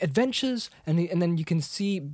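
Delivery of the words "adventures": high dreamy Bilbo. Retold or imitated. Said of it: "he's like".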